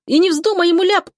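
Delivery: high-pitched urgent warning; warning cry. A narrator's reading. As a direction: stop him before he blurts it. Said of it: "кричит".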